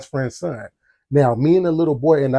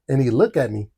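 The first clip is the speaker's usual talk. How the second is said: ordinary delivery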